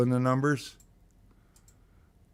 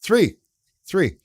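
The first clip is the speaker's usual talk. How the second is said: excited announcement; 9 semitones higher, 8 dB louder than usual. Real, not acted. Wheel lands on number.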